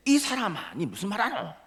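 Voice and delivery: high-pitched disbelieving protest; indignant dialect retort. Acted. Peter denying to the man who noticed his accent, indignant. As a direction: throw the charge back in regional dialect.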